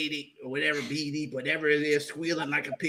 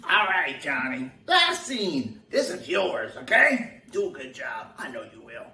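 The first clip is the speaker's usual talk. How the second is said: ordinary talk, nothing marked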